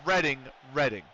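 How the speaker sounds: "Reading" with a short E, "redding". Shown as a demonstration. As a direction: say "Reading" to rhyme with wedding. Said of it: "they don't pronounce Reading".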